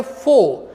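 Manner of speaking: फो with F and silent R; फो as a model to repeat; correct pronunciation. Shown as a demonstration. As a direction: say फो, dropping the final R. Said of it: "आप मेरे साथ बोल सकते हैं".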